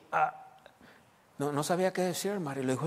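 ordinary talk

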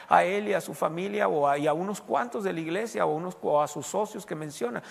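normal talking voice

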